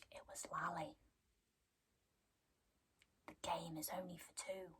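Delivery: hushed eerie whisper; creepy whisper. Retold or imitated. Husband whispering the eerie rule to Selena. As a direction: breathe it like a secret. Cade creepily blaming doll.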